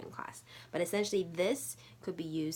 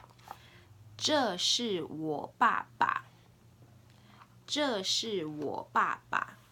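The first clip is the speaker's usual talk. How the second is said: slow, deliberate Mandarin for learners; teacher's drawn-out demonstration; slow language model; 2 semitones higher, 6 dB louder, much slower than usual. Shown as a demonstration. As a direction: model each word slowly and distinctly. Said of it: "say it three times for you to hear".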